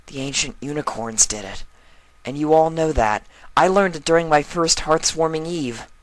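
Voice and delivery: high, earnest filly voice rising; a foal standing her ground; insistent young filly. Acted. Filly insisting to the museum staff, high and earnest.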